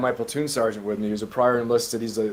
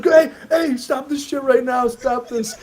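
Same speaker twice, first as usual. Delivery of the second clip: high-pitched, loud command to stop; alarmed captain ordering; 12 semitones higher, 5 dB louder, slower than usual. Retold or imitated. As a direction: imitate an officer breaking up a fight. Captain breaking up staged fight, acted.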